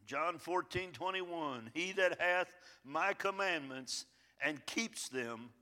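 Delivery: slow, weighty Bible reading; scripture reading. Acted. Pastor reading John to his church, slow and solemn. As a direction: lay each verse down slowly.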